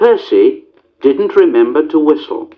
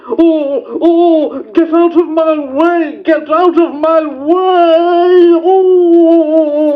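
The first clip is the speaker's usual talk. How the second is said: Gordon yelling; 13 semitones higher, much slower than usual. A narrator's reading. Gordon yelling at Percy on the line, panicked high yell. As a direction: cry out as you rush at him. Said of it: "Gordon yelled".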